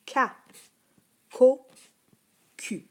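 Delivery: "ko" popped out loud and high with a hard K; teacher demonstrating the hard C sound. Shown as a demonstration. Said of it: "Écoute bien".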